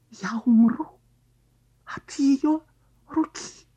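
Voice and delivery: excited whisper. Acted. Carp thinks.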